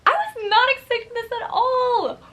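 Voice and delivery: high, loud, overjoyed squeal; real excited squeal; thrilled. Real, not acted. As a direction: tell them, bursting with joy. Reacting to surprise gift.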